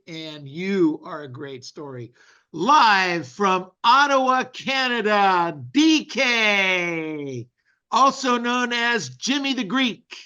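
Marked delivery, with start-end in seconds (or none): 2.5-7.5 slow, much louder ring-announcer call. Acted. Host introduces the guest to the audience, loud announcer call. 7.9-10.2 louder, higher ring-announcer nickname. Acted. Host hypes the guest's nickname, loud announcer call.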